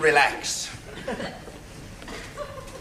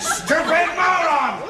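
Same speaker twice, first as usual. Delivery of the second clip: much higher, louder, slow furious shout; 5 dB louder, slower than usual. Acted. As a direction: explode at the fool, yelling. Furious doctor rants at assistant.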